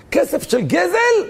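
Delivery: high, slow rhetorical question; scandalized, rising question; incredulous echo. Acted. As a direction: draw it out in shocked disbelief. Incredulous echo of previous line.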